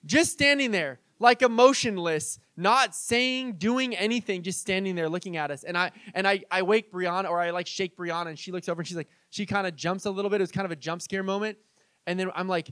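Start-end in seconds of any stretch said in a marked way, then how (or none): none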